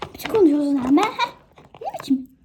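loud, indignant shout; mother outraged at a stranger's insult; angry retort. Acted. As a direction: snap back at the rude woman. Mother quarrelling with rude woman.

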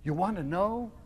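ordinary delivery, nothing marked

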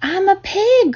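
put-on high-pitched boyish boast; gleeful cry. A narrator's reading. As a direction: cry it out in play. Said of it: "cried Avery".

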